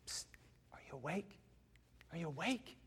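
hushed psst, barely voiced; mock whisper. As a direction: whisper as if waking a sleeper. Preacher mimics waking sleeper.

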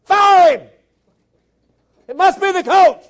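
high, hammed-up yelling at the game; mock angry fan. Preacher imitating fans of a losing team, mock shouting. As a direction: holler like an outraged fan whose team is losing. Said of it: "when they're losing, they're like".

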